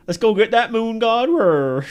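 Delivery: loud, high, slow Western twang; cowboy accent. Pretend. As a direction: ham it up like a cowboy. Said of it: "I just like speaking like a cowboy".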